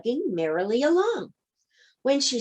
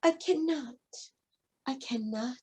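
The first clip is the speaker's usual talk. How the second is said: softer and softer like a train rolling off; a rhythmic mutter growing much softer; chugging refrain fading away; 2 semitones higher, 10 dB quieter than usual. A narrator's reading. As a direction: let it die away into the distance.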